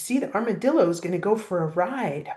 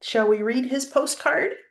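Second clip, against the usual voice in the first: plain reading voice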